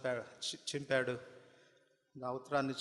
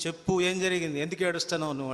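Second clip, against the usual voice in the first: louder, insistent demand to speak; demanding father; 4 semitones higher, 7 dB louder, faster than usual. Acted. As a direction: shake the answer out of him. Father shakes weeping man, demands. (ఆత్రుతగా గట్టిగా అడిగే తండ్రి)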